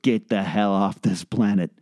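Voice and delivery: ordinary talk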